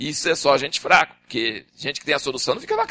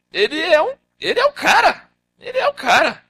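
much higher, emphatic; excited praise; 13 semitones higher, 3 dB louder than usual. Real, not acted. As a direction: rave about him. Exalting Moses, high pitch.

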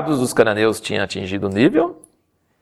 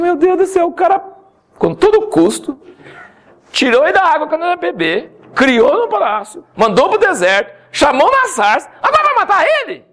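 incredulous outcry. Acted. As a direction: cry out like you can't believe it. Preacher exclaims in disbelief.

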